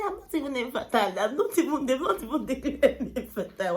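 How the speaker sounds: indignant protest. Retold or imitated. Answering her mother about fertility, indignant and loud. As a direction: push back hard, offended.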